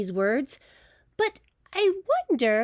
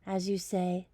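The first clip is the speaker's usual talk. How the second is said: very soft, quiet as you say; soft bedtime lead-in; 4 semitones lower, 10 dB quieter than usual. Acted. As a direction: hush it for bedtime.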